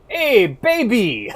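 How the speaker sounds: put-on high, slow, loud dub-English Hey baby; hammed-up anime dub line; Zeppeli quote. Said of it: "Zeppeli going".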